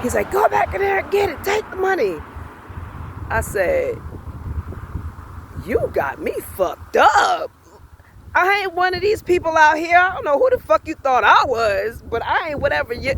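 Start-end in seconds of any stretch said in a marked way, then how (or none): 0.3-2.1 high-pitched mock of an irate man; mock yelling. Man ordering her to take the money, mock yelling. 5.6-13.1 retold cussing out. Telling off the man in her face, retold angry cussing. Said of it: "I was cussing his ass out".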